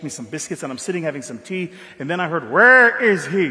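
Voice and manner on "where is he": loud, slow booming call. Retold or imitated. Mandela calling out for the boy, loud and slow. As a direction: boom it out slowly down the hall.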